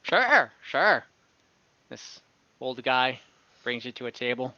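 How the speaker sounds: ordinary talk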